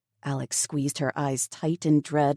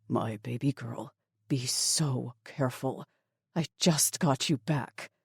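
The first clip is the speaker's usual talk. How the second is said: tender father; 2 semitones lower, 4 dB quieter than usual. A narrator's reading. Father to his daughter before danger, soft and tender. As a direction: hold back tears and say it gently. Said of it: "swiped the tears".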